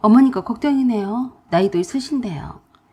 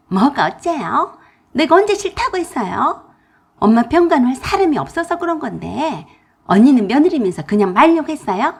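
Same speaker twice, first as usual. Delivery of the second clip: higher, louder indignant retort; angry sister-in-law; 4 semitones higher, 6 dB louder than usual. Acted. Sister-in-law angrily snaps back at narrator. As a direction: snap back in offense.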